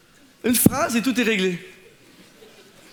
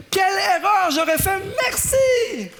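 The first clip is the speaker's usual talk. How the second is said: high, loud cry of thanks; a young woman shouting her thanks to the pastor; grateful shout; 9 semitones higher, 3 dB louder than usual. Retold or imitated. Woman's grateful exclamation acted out.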